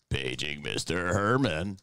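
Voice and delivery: low, slow PA announcer voice; deep slow echoey page; flat echoey pa page. Retold or imitated. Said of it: "and he's like".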